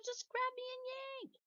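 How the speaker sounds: very high eager pigtail. Acted. Pigtail telling Paul to yank it, very high and eager. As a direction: urge him on with squeaky glee.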